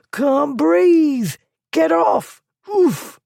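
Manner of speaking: high, loud strangled cries; choking panic. A narrator's reading. Thief choking in Blackwood's grip, high strangled cries. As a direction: gasp it out as feet dangle. Thief being choked, lifted.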